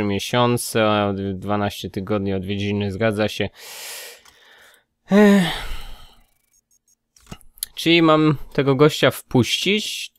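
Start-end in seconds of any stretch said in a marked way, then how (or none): 5.1-5.4 a sharp, high exasperated "ech"; exasperated groan. Real, not acted. Player groans at the inspector's unwelcome request, exasperated. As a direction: groan out loud at the bad news.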